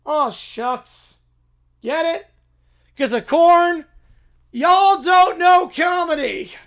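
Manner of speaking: hammed-up catchphrase shout. Applicant shouting his corn joke at the farmer. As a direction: yell the punchline at the farmer.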